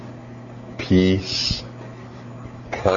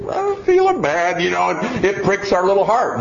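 put-on guilty little kid; 9 semitones higher than usual. Preacher voicing a child feeling bad during the lecture. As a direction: play the pricked little conscience.

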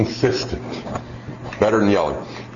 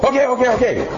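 high, hasty okays; put-on child voice, much higher; alarmed kid giving in; 13 semitones higher, faster than usual. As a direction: cave in to an out-of-control mom. Child reacts to mother out of control.

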